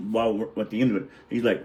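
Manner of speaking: ordinary delivery, nothing marked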